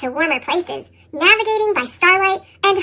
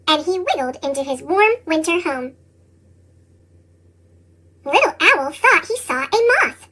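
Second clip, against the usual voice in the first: ordinary delivery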